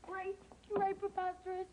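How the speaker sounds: ordinary talk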